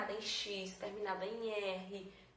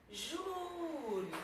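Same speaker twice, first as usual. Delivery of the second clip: Júri with JÚ held long and high; stressed syllable drawn out; 6 semitones higher than usual. Shown as a demonstration. Teacher hunting the tonic syllable, JÚ drawn out. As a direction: stretch a high JÚ, let ri drop. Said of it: "Acabei de encontrar minha sílaba tônica".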